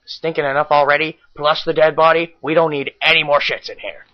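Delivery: ordinary talk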